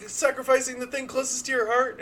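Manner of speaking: high, laughing put-on voice; high character voice. Hatchet Jones presses the doctor, high and laughing. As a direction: play her high and urgent.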